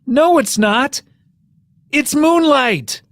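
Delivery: slow, high announcer voice, drawn out; hammed-up Oscar presenter, slow and high; mock award announcer.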